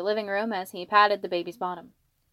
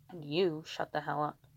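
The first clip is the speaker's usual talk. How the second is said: lower, hushed grumble; low scolding; 4 semitones lower, 7 dB quieter than usual. Acted. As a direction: tell someone off under the baby's hearing. Father hushes laughing friend, baby in arms.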